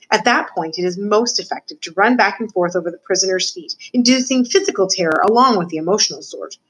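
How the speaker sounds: plain reading voice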